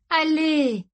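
high, falling plea; insistent urging. A narrator's reading. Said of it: "insista Izzy". (insistance suppliante, voix aiguë)

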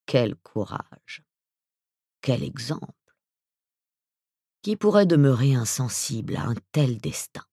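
sugary mock praise, dripping irony; silky ironic voice. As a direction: flatter her with honeyed sarcasm. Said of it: "de sa voix doucereuse, qui se teintait à nouveau d'ironie".

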